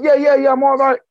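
high, loud quick brush-off; forced reassurance. Retold or imitated. The owner fakes being fine to his member, high brushing-off reply.

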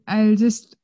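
ordinary talk

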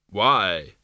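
hollow, grave Death voice; grim reaper voice. Acted. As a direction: ask flatly as Death. Death speaks.

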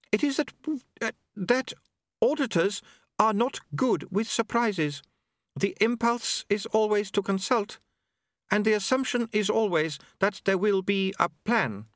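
high, careful voice of a precise woman; Unity, hesitant and formal. Acted. Novel character Unity speaking.

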